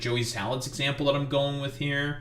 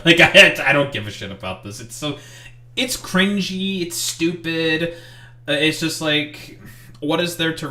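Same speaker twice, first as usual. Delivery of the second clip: disgusted dismissal; 4 semitones higher, 5 dB louder than usual. Real, not acted. Reacting to staged prank video.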